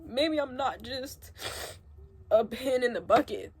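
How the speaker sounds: real tears breaking through the words; crying. Real, not acted. To her viewers, catching a sob mid-sentence. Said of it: "why am I crying".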